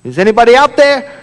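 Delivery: high, loud call up to someone above; calling out for help. A narrator's reading. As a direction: call out hopefully, pitch climbing at the end. Man hanging off branch calls out.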